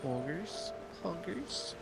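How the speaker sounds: ordinary delivery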